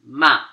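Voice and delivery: short MA falling sharply; fourth tone. Shown as a demonstration. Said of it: "Fourth tone".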